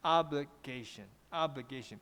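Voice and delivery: careful teacher's model of "obligation", flat and drop; level then falling. Shown as a demonstration. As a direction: keep "obli" level, drop firmly on "gation". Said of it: "所以前面是一声，后面是四声".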